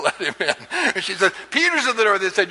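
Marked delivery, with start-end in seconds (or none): 1.5-2.2 high, breathless announcement; a touch of excited servant girl; excited Rhoda. Retold or imitated. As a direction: blurt it out like big news. Rhoda excitedly tells the others.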